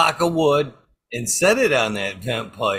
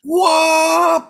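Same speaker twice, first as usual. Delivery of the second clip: a high, loud mock air horn blast; air horn whoop; 6 dB louder than usual. To the guy in the shitter, a high, loud air horn whoop.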